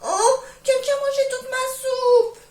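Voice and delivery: very high, loud and whimpering like a crying cub; baby bear crying high over his empty bowl; little bear's tiny voice. Acted. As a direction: wail it like a small bear on the verge of tears. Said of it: "de sa petite voix dit".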